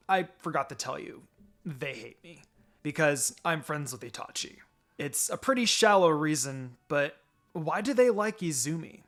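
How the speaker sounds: ordinary delivery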